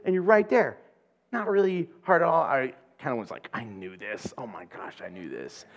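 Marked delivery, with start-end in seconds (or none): 3.5-5.7 much lower, much softer self-scolding; embarrassed mutter to self. Retold or imitated. Realizing she knew the way, soft lower mutter. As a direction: mumble it under your breath, kicking yourself. Realizing the route was obvious.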